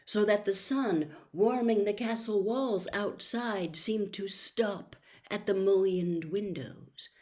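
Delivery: usual speaking voice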